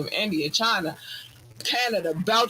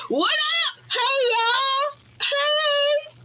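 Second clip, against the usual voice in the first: real squealing excitement; excited squeal. Real, not acted. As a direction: greet them like you can't contain yourself. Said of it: "I'm so excited for that one".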